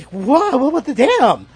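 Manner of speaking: amazed reaction. Retold or imitated. His retold first reaction, laughing.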